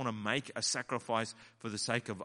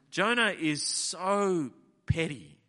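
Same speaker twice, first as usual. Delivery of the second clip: ordinary delivery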